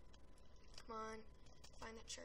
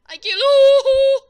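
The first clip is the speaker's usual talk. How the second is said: drawn-out battle roar; 12 semitones higher, 27 dB louder, much slower than usual. Real, not acted. Gamer hyped during the match.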